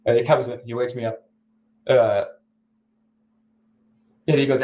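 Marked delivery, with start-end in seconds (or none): none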